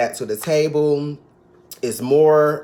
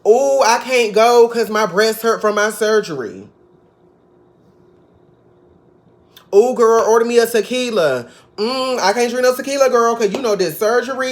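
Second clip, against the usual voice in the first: much higher, louder, fast whiny parody; mock whiny Contessa; 8 semitones higher, 5 dB louder than usual. Mocking Contessa bringing up her surgery, a shrill hammed-up parody. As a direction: ham up the complaining, squealing every ooh. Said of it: "We could be like".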